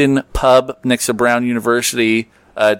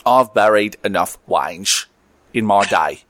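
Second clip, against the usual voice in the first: Michael Caine accent; 5 semitones lower than usual. Shown as a demonstration. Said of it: "I'm just gonna do Michael Caine".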